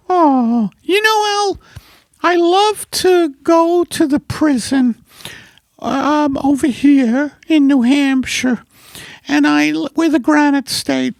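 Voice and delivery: high-pitched put-on wife voice; wife character. Wife corrects her husband about the vaccine, high character voice. Playing wife Margaret in sketch.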